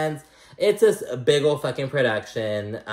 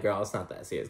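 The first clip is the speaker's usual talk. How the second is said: lower, softer brush-off; dismissive; 4 semitones lower, 9 dB quieter, faster than usual. Retold or imitated. The ladies brushing off Adriana's injury, softly dismissive. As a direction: wave it away, unimpressed. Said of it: "Everyone's like".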